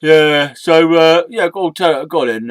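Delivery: normal talking voice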